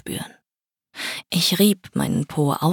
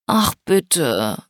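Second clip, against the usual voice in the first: a light pleading "ach bitte"; mild begging plea. A narrator's reading. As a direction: coax him a little, sweetly. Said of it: "bettelte ich".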